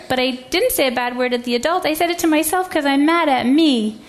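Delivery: a hint of a little girl pouring out her story; upset little girl. Little girl tells her side.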